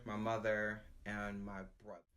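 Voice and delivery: normal talking voice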